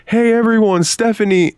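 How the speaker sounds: high, mocking call to the crowd; imitating a bully calling out to the schoolyard; mocking announcement. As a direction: announce it to everyone like a jeering kid. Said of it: "Sean comes out and he goes".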